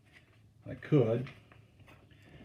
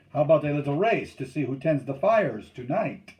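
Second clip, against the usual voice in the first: loud, higher-pitched dare; calling a challenge; 4 semitones higher, 8 dB louder than usual. A narrator's reading. Steersman proposes a race to camp, calling out. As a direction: throw down a challenge. Challenge called to other canoe.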